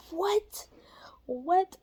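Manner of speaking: high startled what, twice. Retold or imitated. Hearing a stranger's voice in the car, startled. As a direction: jump at the strange voice.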